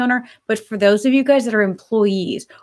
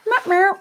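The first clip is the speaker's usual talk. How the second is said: cat meow; 10 semitones higher than usual. Pretend. Meowing at the cat.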